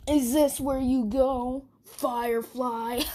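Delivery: slow, mocking bully drawl; sneering bully voice. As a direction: creep up on him with a sneer. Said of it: "Bakugo's like".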